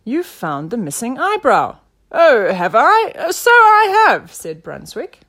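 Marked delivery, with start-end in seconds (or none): 0.0-1.8 raised, louder exclamation; crying out. A narrator's reading. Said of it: "cried Nelson". 2.1-4.3 loud, high, stretched-out surprise; puzzled, then claiming the credit; surprised inspector. A narrator's reading. Inspector surprised by own success.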